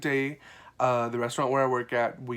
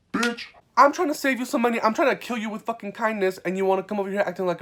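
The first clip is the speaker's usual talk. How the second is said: high, loud furious rant; venting fury at a customer; angry outburst; 8 semitones higher, 5 dB louder than usual. Retold or imitated. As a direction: blow up at the rude customer. Ranting at a rude customer.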